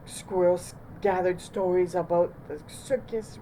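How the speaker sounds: usual speaking voice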